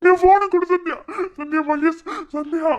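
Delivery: high, loud frantic cry; panicked shout. Acted. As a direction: scream for the phone in panic. Panicked demand for the phone.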